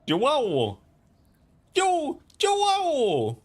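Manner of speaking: high-pitched yell. Real, not acted. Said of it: "I need to stop yelling".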